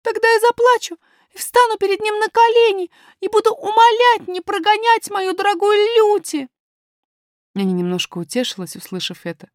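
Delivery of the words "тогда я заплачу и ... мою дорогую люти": put-on very high, loud child's voice; high-pitched, impassioned pleading; little princess, imploring. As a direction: promise fervently as a small girl.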